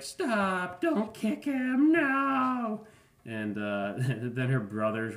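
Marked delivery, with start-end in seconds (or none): 0.0-2.9 high, loud screaming plea, slowing down; retold scream. Girl screaming at a man kicking her dog, high shrieks. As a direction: shriek at him to stop, much higher. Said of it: "this girl's screaming".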